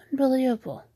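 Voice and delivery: ordinary talk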